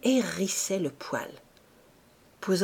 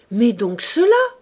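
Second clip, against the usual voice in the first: a lightly voiced husband, loud and eager; husband, greedy; 4 semitones higher, 9 dB louder than usual. A narrator's reading. Husband rummaging the drawers, eager and loud. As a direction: toss her the nightgown with a leer. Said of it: "dit-il en jetant une chemise".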